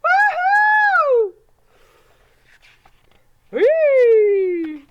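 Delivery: high, loud whooping shout; joyous cheer. Acted. Celebrating the wolf's surprise party, loud joyous whoop. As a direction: whoop like the party just popped.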